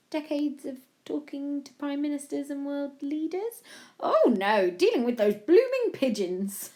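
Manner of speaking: grand royal delivery breaking into amusement; joking Queen. A narrator's reading. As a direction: play a queen teasing her guest.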